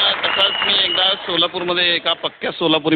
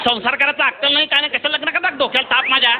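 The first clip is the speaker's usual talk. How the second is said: scolding brother on the phone; 6 semitones higher than usual. Retold or imitated. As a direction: tell her off then calm her. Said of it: "फक्त ताईच म्हणाला".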